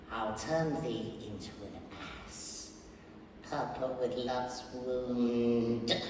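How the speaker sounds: soft, breathy, slow spell-casting hush; sinister whisper. Acted. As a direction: whisper the curse like a menacing fairy. Puck casts his spell.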